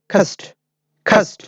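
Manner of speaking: "cast" with a breath-puffed k, khast; model pronunciation. Shown as a demonstration. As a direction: say "cast" as a careful isolated model, k aspirated. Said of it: "Maybe এভাবে বলতে হবে".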